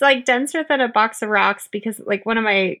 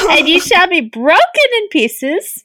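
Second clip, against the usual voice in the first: slow, higher, Swedish-German lilt; botched German-sounding accent; 5 semitones higher, slower than usual. Acted. Co-host's urchin voice drifting, botched German-Swedish accent. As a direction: read it in a muddled German put-on. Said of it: "It's German, isn't it?".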